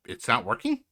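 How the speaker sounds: high-pitched questioning rise; mock disbelief. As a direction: feign surprise at the news. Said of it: "At which I have to say".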